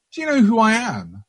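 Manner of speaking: pompous voice. Acted. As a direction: sneer it like a spoiled rich kid. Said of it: "in a slightly pompous voice".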